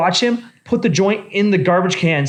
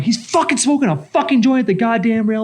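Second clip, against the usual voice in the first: higher-pitched, outraged report; worked-up retelling, no shouting; retold freak-out; 7 semitones higher than usual. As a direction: act out an incensed complaint to security. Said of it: "I am flipping shit".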